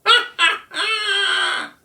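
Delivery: very high, loud cackling bird voice; kookaburra laughing shout. A narrator's reading. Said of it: "Colin laughing at him".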